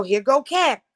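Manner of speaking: ordinary talk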